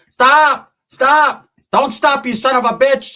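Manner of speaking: high, loud mock-female yelling; tía crying out. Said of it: "My tía was out there".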